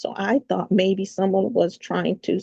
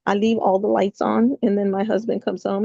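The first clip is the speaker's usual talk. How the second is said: usual speaking voice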